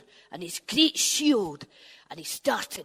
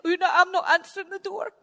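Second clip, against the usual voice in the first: hurried scared refusal; 8 semitones higher, faster than usual. Acted.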